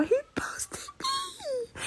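breaking into a choked, whispered cry; almost crying, barely voiced; tearful whisper. Real, not acted. Teen overcome by her idol's post, tearful whisper. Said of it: "I'm gonna cry".

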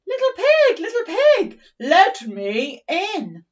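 high, loud, slow wolf call; hammed-up wolf calling at the door; big bad wolf. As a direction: boom it out like the hungry wolf. Retelling, wolf's line acted.

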